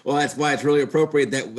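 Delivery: ordinary talk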